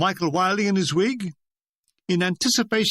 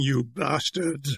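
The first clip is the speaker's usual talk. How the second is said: muttered insult; 6 semitones lower, 4 dB quieter, slower than usual. A narrator's reading.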